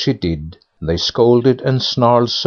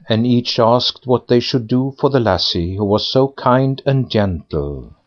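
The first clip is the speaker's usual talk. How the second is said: ordinary delivery, nothing marked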